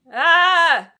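loud, high overwhelmed shriek; a fan scream at a touching scene; real emotional scream. Real, not acted. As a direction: let out a burst of real emotion. Overcome by the proud moment.

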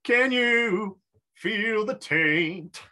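very slow, spooky hammed question; slow mock-mysterious ask; slow mock ominous. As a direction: draw it out like a dark prophecy. Mock solemn roleplay.

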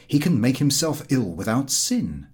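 ordinary delivery, nothing marked

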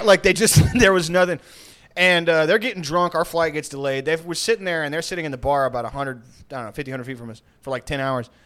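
ordinary talk

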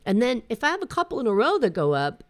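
ordinary delivery

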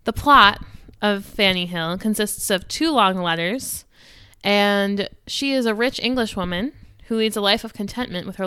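loud, deliberate storytelling read; dramatic summary read. Acted. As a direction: narrate the plot like a presenter. Reads plot summary aloud.

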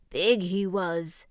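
put-on slow, drawn-out old frog; self-inflating frog, stretched words; puffing old frog. A narrator's reading. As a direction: puff up as you speak. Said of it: "puffing herself up".

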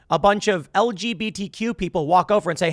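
normal talking voice

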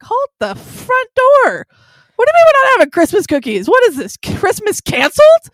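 high, loud, indignant yell, hammed up; mock outraged shout. Retelling to a friend her outrage at mom, mock indignant shout. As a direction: play up the shock like Christmas is being canceled. Retold outrage over no cookies.

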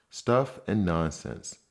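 ordinary delivery

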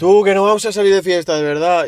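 high, put-on voice swearing they won't party; mock earnest promise. Friend mimics the gang promising no night out, hammed-up high voice. Mocking their own promise.

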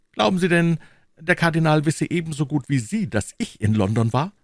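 put-on eager young hero questioning his superior; young D'Artagnan, indignant.

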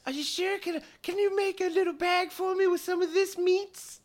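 very high little creature voice; pleading pet creature. Acted. Pet asking for a bag of meat, high pleading voice. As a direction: ask sweetly in a tiny, high pet voice. Game master plays pet Kokolo.

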